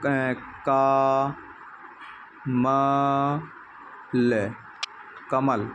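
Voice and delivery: slow, each syllable split and held; sounding out syllables. Shown as a demonstration. Teacher sounding out कमल syllable by syllable for a child. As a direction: spell it out slowly for a child. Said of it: "हम जोड़ के पढ़ाते हैं ना".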